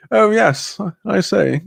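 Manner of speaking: hammed-up upper-class British voice; posh toff. Streamer teasing people who use full names, posh toff. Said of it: "makes me feel, like, rather".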